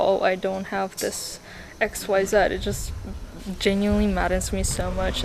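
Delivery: ordinary talk, nothing marked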